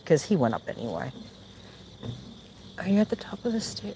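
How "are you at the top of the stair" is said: high sing-song question to a cat; pet talk. Real, not acted. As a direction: coax the cat down. Talking to the cat.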